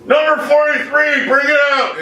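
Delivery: high, hammed-up clerk's holler; stretched mock stockroom call. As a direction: shout an order to the back.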